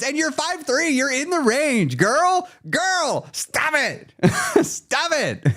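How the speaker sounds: high, drawn-out sassy scold. Real, not acted. Scolding a viewer who downplays her progress, high sassy voice. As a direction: call her out, big-sister sass.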